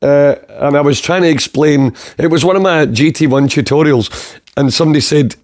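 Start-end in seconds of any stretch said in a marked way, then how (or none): none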